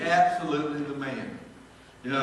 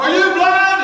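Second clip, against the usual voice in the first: loud high yell at the ref; hollering; 14 dB louder, slower than usual. Retold or imitated. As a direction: shout it again, full voice. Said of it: "And I hollered".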